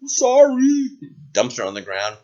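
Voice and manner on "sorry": high-pitched helpless apology; meek servant voice, pitched way up; sheepish guard. Acted. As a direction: squeak a reluctant sorry. Guard apologizes while obeying.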